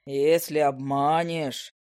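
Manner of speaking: low, slow gnome threat; threatening gnome. Acted. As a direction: let the threat hang.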